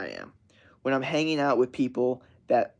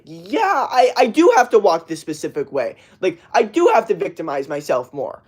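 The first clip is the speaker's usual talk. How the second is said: higher-pitched imitation of a needy child; mock self-pitying kid; 4 semitones higher, 4 dB louder than usual. Kid milking sympathy from the parent, mock self-pity. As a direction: play up the victim, pitched high.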